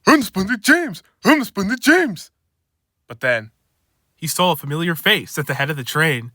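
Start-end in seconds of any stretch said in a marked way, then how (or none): 0.1-2.3 higher, sing-song bragging; gleeful boast. Acted. James brags to himself about the express. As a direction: crow about yourself.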